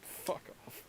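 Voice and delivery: whispered, unvoiced. Real, not acted. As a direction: breathe it out without voice. Whispered aside.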